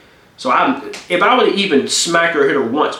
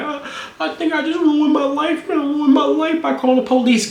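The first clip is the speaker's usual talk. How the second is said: mock girlfriend panic; 12 semitones higher than usual. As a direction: mimic her regretful wail. Imitating girlfriend on phone.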